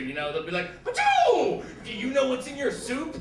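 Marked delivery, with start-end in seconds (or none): 0.8-1.6 very high, loud laser zap; laser sound effect. Retold or imitated. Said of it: "like, the laser sound effects". 1.8-3.2 high, urgent mock teaser; teasing news anchor. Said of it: "hook you in by asking you questions".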